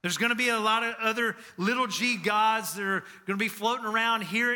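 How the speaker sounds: usual speaking voice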